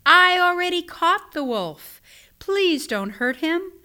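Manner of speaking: put-on high, urgent boyish plea; yelling. A narrator's reading. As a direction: call out high, slowing into a plea. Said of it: "yelled Peter".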